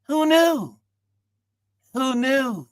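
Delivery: very high, drawn-out rhetorical question; high-pitched exclamation of wonder; marvelling. Real, not acted. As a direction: marvel in a high singing call. Marvels at unexpected outcome.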